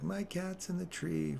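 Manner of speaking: put-on high, slow little-girl whine. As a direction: play the worried kid upstairs of the tree. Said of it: "The little girl was all like".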